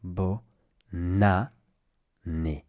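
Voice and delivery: bonne année split as bo, na, né; syllable by syllable. Shown as a demonstration. Teacher showing learners where the N goes, syllable by syllable. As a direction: move the N of bonne onto année, slowly. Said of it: "you should say it like this".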